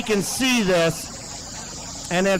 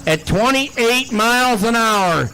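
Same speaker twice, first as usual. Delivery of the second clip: announcer call; 4 dB louder than usual. Acted. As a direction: boom it out to the crowd. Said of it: "make an announcer out of you yet".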